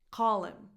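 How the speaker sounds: "Call" stressed, "him" reduced to "im"; one linked word, "CALL-im"; dropped H. Shown as a demonstration. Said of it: "the H in these words can be dropped".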